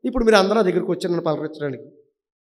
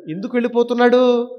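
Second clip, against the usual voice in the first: ordinary talk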